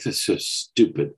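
mock-disgusted teen grumble; disgusted sigh. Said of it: "she gives a sigh of disgust".

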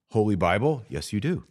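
usual speaking voice

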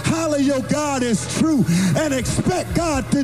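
high, chanted preacher's whoop, building to a shout; preaching at full force. Acted. Pastor whooping to the congregation on the last Sunday, sung and shouted. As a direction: ring it out over the organ like a Sunday climax.

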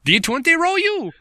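put-on high, loud laughing Russian accent; mock Russian accent. Joking about accents on the podcast, a high laughing mock Russian accent. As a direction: ham up a cartoon Russian through giggles.

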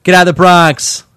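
put-on loud, slow Bronx accent; New York accent. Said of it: "okay, New Yorkers and".

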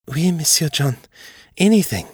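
lifted voice, devoted French servant; eager French maid. A narrator's reading.

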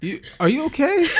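high, loud teasing laugh. Real, not acted. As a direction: tease her through a laugh. Teasing friend who is tearing up.

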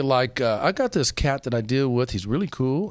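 ordinary delivery

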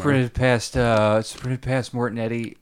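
breathless with sighs and puffs; out of breath. Real, not acted. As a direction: still winded, out of breath. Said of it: "Sorry, I'm a little out of breath".

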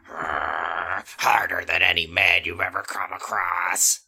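low, slow growl; almost a growl. A narrator's reading. Chris boasts to Danny, almost growling. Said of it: "said Chris in an almost growl".

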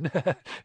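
short jittery titter; nervous laugh. A narrator's reading. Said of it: "He laughed nervously".

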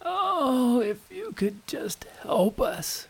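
high, slow, whispered plea; high pleading whisper. A narrator's reading. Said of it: "as if they were praying and saying".

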